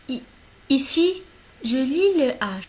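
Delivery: ordinary talk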